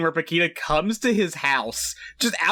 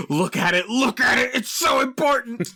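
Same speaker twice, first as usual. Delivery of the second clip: dramatic; 6 semitones higher than usual. Retold or imitated. Mock camera voice.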